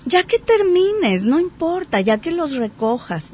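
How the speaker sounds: pushy friend. Pretend. Friend insists on car.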